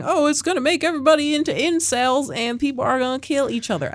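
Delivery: high, hammed-up anxious critic voice. Podcast host mimicking people panicking about Joker, mock-anxious. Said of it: "anxious critic voice".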